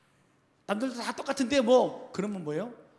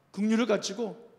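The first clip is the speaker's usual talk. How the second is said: ordinary talk, nothing marked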